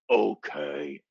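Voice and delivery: low, dopey-slow wrestler impression; hammed-up low wrestler voice; Braun Strowman. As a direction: answer low as a cowed brute. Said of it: "And Braun's like".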